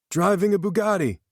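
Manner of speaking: high-pitched eager yell. A narrator's reading. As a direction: shout out a guess, pitched high. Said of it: "Tanner yelled next".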